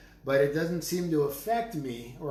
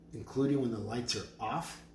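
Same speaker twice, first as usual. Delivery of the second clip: ordinary talk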